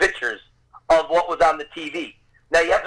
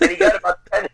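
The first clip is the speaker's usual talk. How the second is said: laughing; 13 semitones higher, 3 dB louder, faster than usual. Real, not acted. Laughing at his own story.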